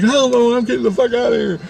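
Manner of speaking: high, hurried squeal; panicked refusal. Retold or imitated. Dawn refusing and fleeing the shop, panicked refusal. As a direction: imitate a frantic bolt for the door.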